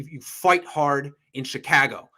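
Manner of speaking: "fight hard in Chicago" slow, Midwest-accent vowels. Shown as a demonstration. Co-host imitating a Midwest accent for his partner, slow. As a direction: drawl it out like a Chicago local. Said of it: "That is very Midwest".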